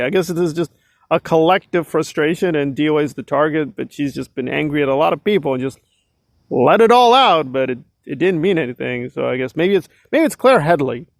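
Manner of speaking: normal talking voice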